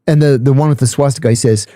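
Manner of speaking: ordinary talk, nothing marked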